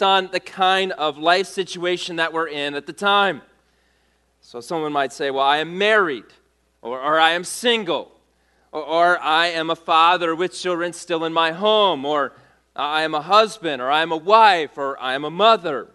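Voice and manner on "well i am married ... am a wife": ordinary delivery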